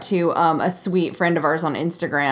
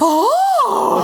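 high, hammed-up astonished "oh"; mock surprise. Imitating Samantha's look in bed, mock surprised high "oh". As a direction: imitate a startled, high-pitched gasp. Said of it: "she looks surprised. She's like".

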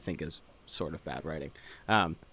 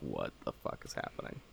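voiceless, almost whispered aside; hushed bystander mutter; 11 semitones lower, 12 dB quieter than usual. Retold or imitated. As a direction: mutter it like a stunned onlooker.

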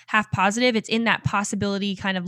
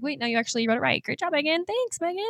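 self-praise; 8 semitones higher, 4 dB quieter than usual. Retold or imitated. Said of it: "And then I was like".

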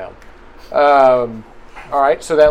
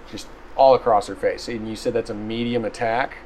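ordinary talk, nothing marked

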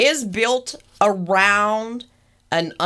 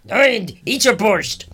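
slow, hammed-up fake Russian accent; a lightly done mock Russian accent; bad Russian accent; 2 semitones higher, 3 dB louder than usual. Host joking about the Russian park in a bad Russian accent. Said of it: "That's a really bad Russian accent".

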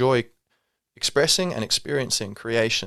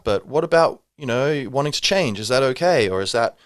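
ordinary talk, nothing marked

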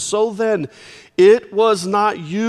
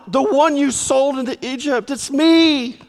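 higher, pressing urgently; urgent insistence; 7 semitones higher than usual. Acted. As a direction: convince them it is really him. Joseph insisting to stunned brothers.

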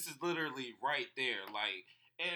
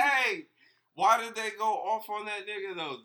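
loud excited question; 7 semitones higher, 11 dB louder than usual. Real, not acted. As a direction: jump in loudly.

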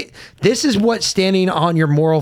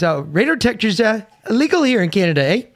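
mocking a Canadian cop, higher and quick; a light Canadian cop impression; mock Canadian accent; 7 semitones higher, faster than usual. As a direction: put on a jokey Canadian "eh".

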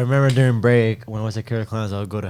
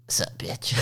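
whispered, teasing hello; whispered greeting; 9 dB quieter, slower than usual. Retold or imitated. Friend greeting the scare actor, whispered and teasing. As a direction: whisper it like a private joke. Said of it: "And he's like".

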